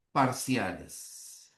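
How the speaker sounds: ordinary talk, nothing marked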